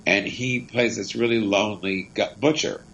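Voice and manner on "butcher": usual speaking voice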